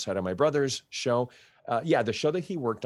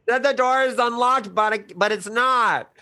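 mock calling out; 12 semitones higher, 8 dB louder than usual. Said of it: "It's like, you know".

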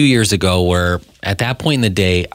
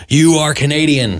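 raised, emphatic mock command; mock stern deprogrammer; 6 semitones higher than usual. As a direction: order it like a light-hearted brainwasher. Acting hired deprogrammer scolding returnee.

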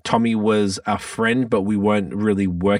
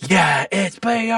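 high, slow pet talk; dog voice; 11 semitones higher, much slower than usual. Real, not acted. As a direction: stretch it out sweetly to the dog. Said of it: "Everyone heard your dog voice now".

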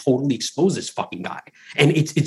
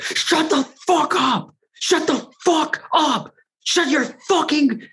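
much higher, slow, drawn-out yelling; imitated furious scream; slower than usual. Host imitating the upstairs neighbor screaming at his dog, high slow yell. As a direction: bellow it like a guy losing it at his barking dog. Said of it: "screaming, loud, loud, loud".

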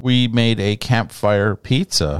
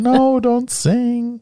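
mock plea; 13 semitones higher, much slower than usual. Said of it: "people screaming and holding their ears going".

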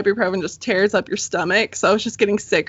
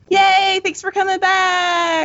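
excited; 7 semitones higher, slower than usual. Real, not acted. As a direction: greet them with a happy shout. Greeting the returning guest.

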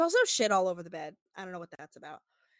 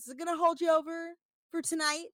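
high, sweet mocking tone; pitched-up teasing question; flirty voice; 8 semitones higher than usual. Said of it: "She goes".

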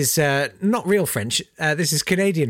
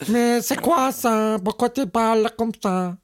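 mock snooty French; 6 semitones higher than usual. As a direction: sneer at the odd accent in a Parisian voice. Mocking how French sounds to Quebec.

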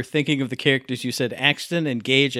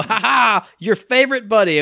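mock cheesy fan; 8 semitones higher, 6 dB louder than usual. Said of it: "And I'll go".

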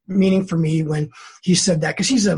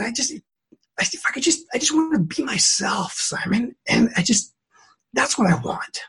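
upset pleading; 5 semitones higher, 3 dB quieter than usual. Retold or imitated. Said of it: "I was a little bit upset".

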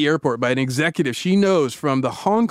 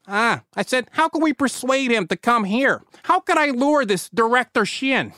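put-on comical Chicago accent, pitched well up; hammed-up Chicago guy voice; 7 semitones higher than usual. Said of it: "go with this pure Chicago".

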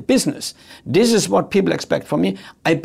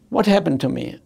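ordinary talk

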